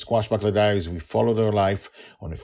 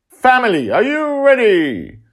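rallying showman; 12 dB louder than usual. Acted. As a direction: stretch it out like a ringmaster. Justin rallying the family.

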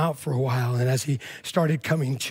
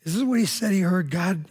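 ordinary delivery

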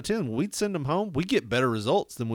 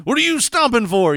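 put-on higher, louder scolding of a teen; scolding parent; 10 semitones higher, 8 dB louder than usual. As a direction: snap at a stomping teenager. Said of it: "we're like".

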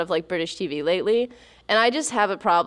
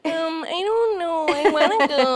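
higher, slow, wavering unsure whine; hesitant friend; 9 semitones higher, slower than usual. Retold or imitated. Playing the friend who never books, hesitant whine. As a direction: dither like a flaky friend. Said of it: "I've been that friend".

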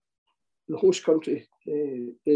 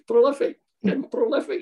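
higher, sarcastic jibe; high mocking sarcasm; 8 semitones higher, 4 dB louder than usual. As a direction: sneer the word prolific at a teammate.

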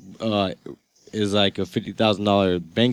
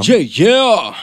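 hyped; 8 semitones higher, 6 dB louder than usual. Real, not acted. Excited hype for guest.

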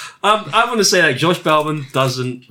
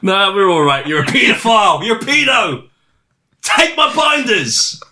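mock dismissive official; 6 semitones higher, 4 dB louder than usual. Acted rejection of CEO offer.

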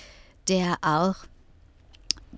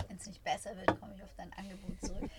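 real frog in throat, softer and strained; 15 dB quieter, faster than usual. Real, not acted. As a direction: croak it out past a tickle in the throat. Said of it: "Ich hab irgendwas im Hals stecken".

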